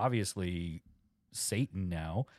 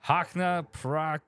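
mumbled incantation; 7 semitones higher, slower than usual. Retold or imitated. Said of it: "mumble mouth like an incantation".

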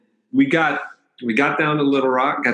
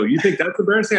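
higher-pitched incredulous question; retold incredulous comeback; 6 semitones higher, faster than usual. Man answers the embarrassed cousin in the street, incredulous comeback. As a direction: retell it as a disbelieving comeback, pitched up. Topping the embarrassed girl's complaint.

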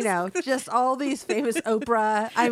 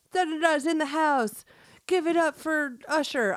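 high-pitched mock announcer; SNL host introduction; 8 semitones higher, 3 dB louder than usual. Co-host introduces a pretend guest, high-pitched show host. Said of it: "it's an S N L thing".

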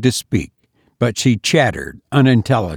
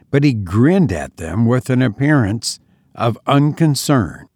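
usual speaking voice

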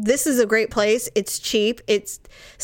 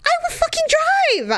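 much higher, half-laughing fury; mock outrage. Real, not acted. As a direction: squeal with comic indignation at her sister. Outraged at sister's text.

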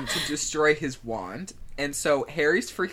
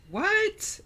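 very high-pitched disbelief; hammed-up plot-twist gasp of a word; mock shock. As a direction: squeak it out in pretend astonishment. Feigned shock at plot turn.

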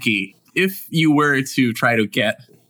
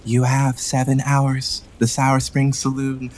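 put-on slow, eerie child messenger; drawn out, unsettling kid voice; creepy child voice; slower than usual.

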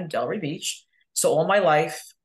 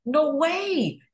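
shocked disbelief; 11 semitones higher, 3 dB louder than usual. Retold or imitated. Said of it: "I said".

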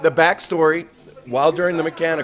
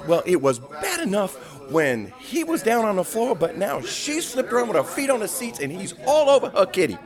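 much higher, scandalized report; scandalized passenger; 8 semitones higher than usual. Retold or imitated. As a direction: tell the purser the shocking news. Passenger reports couple on seats.

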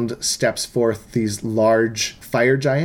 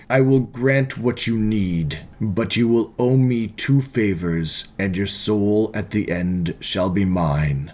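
deep devil voice; 3 semitones lower than usual. Acted. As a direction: speak as an ancient devil granting favors. Devil offers the deal.

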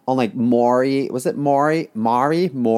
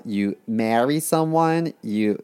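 normal talking voice

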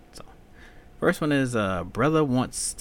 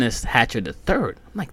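ordinary talk

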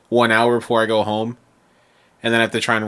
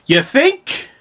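high mock sarcasm; sarcastic; 5 dB louder than usual. Clerk answering the department heads, high sarcastic retort. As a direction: throw it back dripping with sarcasm. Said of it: "I'm like".

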